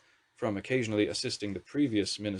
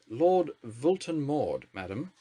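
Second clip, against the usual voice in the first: weary correction; 4 semitones higher, much slower than usual. A narrator's reading. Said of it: "with a stifled sigh".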